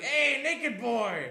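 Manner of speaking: slow, laughing, teasing shout; teasing call. Retold or imitated. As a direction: tease him across the room. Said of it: "he's like".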